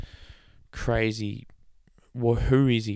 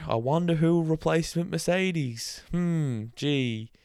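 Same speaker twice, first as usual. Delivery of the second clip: mock wonder; 6 semitones higher, slower than usual. Mock wonder, successor is obvious.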